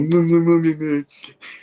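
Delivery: playful "mmm-mwah" babble; mushy lovey-dovey noise. Retold or imitated. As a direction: hum wordless cutesy mush. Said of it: "getting all mushy".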